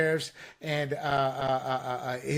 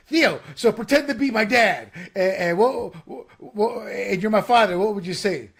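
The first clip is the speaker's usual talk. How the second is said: lightly acted comedian setting up a skit, louder; Bobby Lee; 3 semitones higher, 5 dB louder than usual. Comedian asking Theo to play his dad, lightly imitated. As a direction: raise the voice a little, eager retold prompt. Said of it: "He goes".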